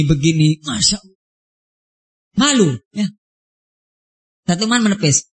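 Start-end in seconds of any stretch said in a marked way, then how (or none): none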